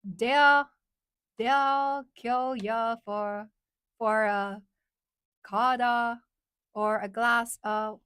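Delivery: loud, halting New York accent attempt; slow, exaggerated New York vowels. Shown as a demonstration. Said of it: "Let me try again".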